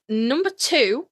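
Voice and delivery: ordinary talk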